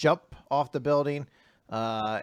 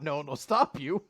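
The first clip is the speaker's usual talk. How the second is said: ordinary talk, nothing marked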